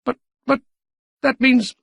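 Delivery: high, stammering shock; sputtering. A narrator's reading. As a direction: sputter helplessly.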